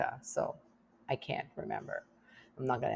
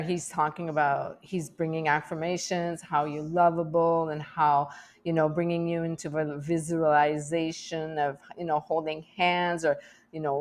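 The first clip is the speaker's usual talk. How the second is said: ordinary delivery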